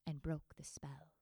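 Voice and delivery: whispered, like a secret; a soft whispered ending; hushed close. A narrator's reading. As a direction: breathe the last words out. Magic spell undone, hushed.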